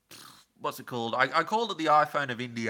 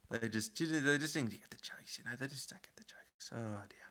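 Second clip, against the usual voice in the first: whisper; 8 dB quieter than usual. Real, not acted. Weary complaint about viewers.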